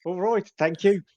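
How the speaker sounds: hammed-up high Birmingham accent; confused old man in a high Birmingham accent. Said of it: "give me a Birmingham accent quickly".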